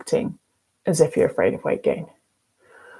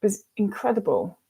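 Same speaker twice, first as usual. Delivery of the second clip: ordinary talk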